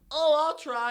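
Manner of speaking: high, loud mock eagerness; mock eager small guy. Small guys eagerly volunteering to arm wrestle, mock high voice. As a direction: squeak it out like a cocky little guy.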